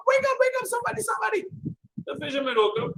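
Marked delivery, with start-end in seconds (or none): 0.1-1.5 high, urgent knocking call. Acted. Robber at the fisherman's door, high and urgent. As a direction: rouse the house in a panic.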